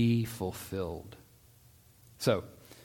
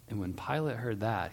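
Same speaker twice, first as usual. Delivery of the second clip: ordinary delivery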